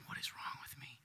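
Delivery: hushed self-doubting whisper. Retold or imitated. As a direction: mutter it under your breath, unvoiced. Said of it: "walk away and go".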